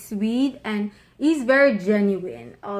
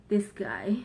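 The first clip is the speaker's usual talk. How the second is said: whisper; 5 dB quieter than usual. Real, not acted. Wife boasting about husband to viewers.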